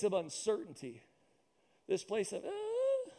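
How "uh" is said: voice squeak. Real, not acted. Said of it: "the squeaky".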